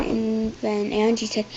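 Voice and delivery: ordinary delivery, nothing marked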